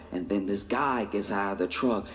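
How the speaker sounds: ordinary talk, nothing marked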